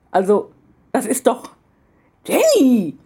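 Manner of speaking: grandfatherly mock outrage at a cheeky theft; scolding. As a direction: splutter out a shocked scolding. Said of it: "fing er dann an zu schimpfen".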